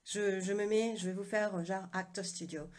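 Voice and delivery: ordinary delivery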